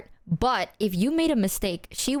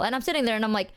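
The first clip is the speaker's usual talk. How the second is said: ordinary talk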